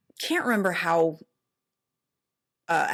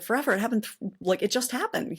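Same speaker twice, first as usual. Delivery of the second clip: higher, lightly voiced Sally; a hint of Sally protesting, pitched up; retelling her indignant reply in a raised voice; mock indignant Sally; 6 semitones higher than usual. Sally protests in retold scene.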